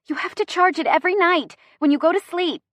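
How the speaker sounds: high, insistent telling-off; lecturing. A narrator's reading. Chrissy lectures driver about charging phone, insistent. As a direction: inform them as if it were obvious. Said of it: "Chrissy informs me".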